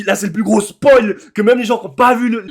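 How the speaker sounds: normal talking voice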